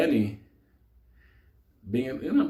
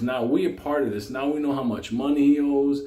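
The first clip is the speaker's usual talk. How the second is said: ordinary talk, nothing marked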